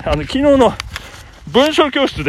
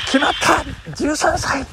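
famous sports commentary quote; 7 semitones higher, much slower than usual. Retold or imitated. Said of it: "名実況っていうのが".

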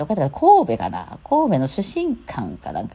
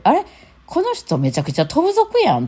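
delighted surprise; 12 semitones higher than usual. Retold or imitated. Realizing he is a zoner type.

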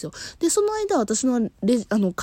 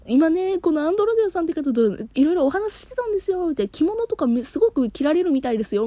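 chatty clerk; 9 semitones higher than usual. Retold or imitated. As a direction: report chattily to the boss.

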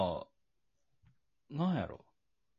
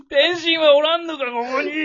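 hammed-up crying shout, much higher and louder; laughing, wailing fake challenge; mock tearful yell; 11 dB louder than usual. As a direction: demand him through fake sobs. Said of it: "みたいな".

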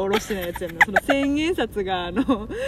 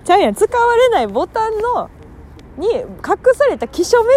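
emphatic; 10 semitones higher, 6 dB louder than usual. Real, not acted. Host insists on the real point.

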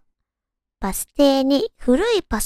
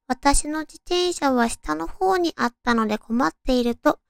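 ordinary talk

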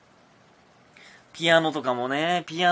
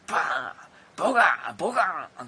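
slam sound effects; 3 semitones higher than usual. Retold or imitated. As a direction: mimic a bag slammed on the floor. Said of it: "カバンとかを床に叩きつけて". (物を叩きつける擬音)

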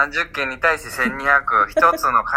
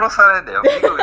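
mock Kanto dialect; 5 semitones higher, 5 dB louder, faster than usual. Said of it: "だよねとか言ってほしいわ".

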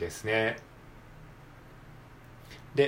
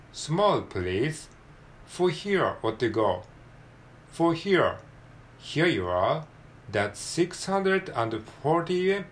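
slow, deliberate English skit reading; careful textbook read-aloud; 5 semitones higher, much slower than usual. Shown as a demonstration. Learner reading a fast-food English skit aloud, slow and careful. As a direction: read each phrase slowly with lively, lifted intonation. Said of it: "では読んでいきましょう".

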